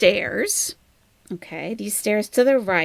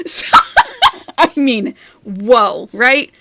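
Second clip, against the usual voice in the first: laughing; 4 semitones higher, 9 dB louder, slower than usual. Real, not acted. Laughing after retelling.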